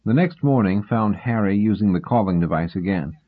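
usual speaking voice

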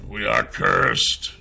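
slow, ominous creature voice; grave cursed king. Acted. Cursed king warns Nath of evil, slow ominous voice. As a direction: intone the warning slowly, with weight.

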